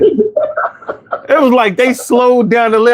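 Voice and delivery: slow, high laughing delivery; laughing while telling. Real, not acted. Telling a friend about first high, laughing. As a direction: laughs through the memory of getting high.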